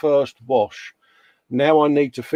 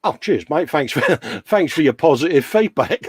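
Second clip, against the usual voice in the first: normal talking voice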